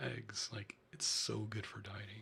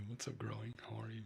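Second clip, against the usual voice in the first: soft unvoiced whisper to chat; breathy close whisper greeting viewers; ASMR whisper; 5 dB quieter than usual. Real, not acted.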